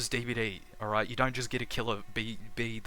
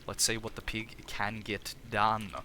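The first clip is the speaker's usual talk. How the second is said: ordinary talk, nothing marked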